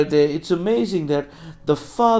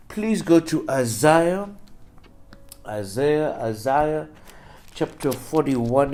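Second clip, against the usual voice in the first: normal talking voice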